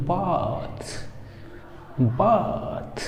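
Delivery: बाथ with a long held "aa"; slow demonstration of बाथ, "baaath"; stretched long vowel. Shown as a demonstration. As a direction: draw out the vowel in बाथ, softly releasing the end. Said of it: "थोड़ा सा ज़्यादा खींचेगा".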